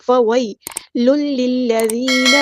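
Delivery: slow, drawn-out Quranic recitation; Tajweed recitation. Shown as a demonstration. Teacher models the verse word, slow Tajweed recitation. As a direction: recite slowly, holding each long vowel.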